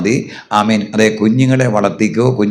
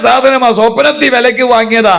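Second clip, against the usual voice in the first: high, loud fervent sermon; fervent pulpit preaching; 10 semitones higher, 3 dB louder than usual. Real, not acted. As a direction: preach at full force. Full-force preaching.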